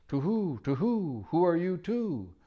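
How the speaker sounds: high, hooting owl voice; soft hooting owl. Acted. Owl greeting the children in a soft hoot. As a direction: hoot the words like a sleepy owl. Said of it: "said in a soft, hooting kind of voice".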